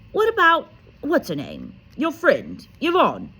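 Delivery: high, loud fussy aunt. A narrator's reading. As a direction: squeal the suggestion up high. Aunt voiced in story.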